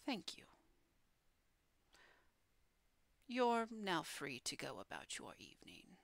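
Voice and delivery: hushed, whispery fiend voice; dismissive pit fiend. Acted. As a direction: dismiss them softly, starting in a whisper. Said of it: "The pit fiend turns back to all of you".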